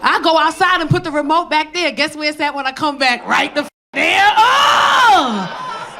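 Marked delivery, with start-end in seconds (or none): 4.4-6.0 high, drawn-out whoop of bliss; hammed-up cry of delight; exultant cry. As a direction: let out a long, rising and falling oh. Exulting in living alone.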